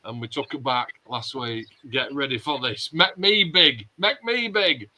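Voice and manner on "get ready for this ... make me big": ordinary talk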